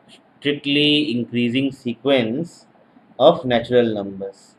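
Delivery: slow, word by word for notes; dictating slowly to the class; slow dictation. Shown as a demonstration. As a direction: spell it out so students can write.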